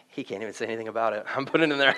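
low, quick mutter as John; sly private aside. Retold or imitated. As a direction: mutter it low and fast to yourself. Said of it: "he's sitting there and he's like".

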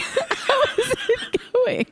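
high, slow laughing repeat; words stretched through giggles. Real, not acted. Repeats question while laughing.

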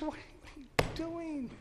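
high, soft, slow rebuke; calm a frightened friend quietly; gentle master. Acted. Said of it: "The master turns to Peter and says".

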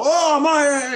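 high Aussie grumbling outburst; hammed-up Australian irritation; pissed-off Australian. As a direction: complain like an annoyed Australian. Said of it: "like, pissed off".

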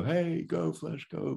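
high-pitched crowd cheer; high, excited spectator's cheer. Acted. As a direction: cheer Flash on from the stands. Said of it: "give chances for everyone to go".

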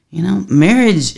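usual speaking voice

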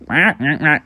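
short run of duck quacks; quacking ducks. Acted. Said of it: "And they were like".

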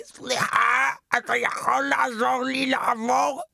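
put-on slow, quavering old woman's plea; frail old lady. Old woman asks him to help her cross, slow quavering plea. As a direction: play a ninety-year-old begging for help.

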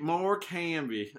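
put-on slow, drawn-out Texas accent. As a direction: stretch it out in a hammy Texas drawl. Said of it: "me affecting a Texas accent for that".

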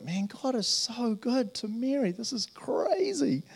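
imitating an amazed listener; awed wonder. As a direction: let it out in slow wonder. Awed exclamation.

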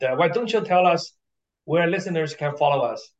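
usual speaking voice

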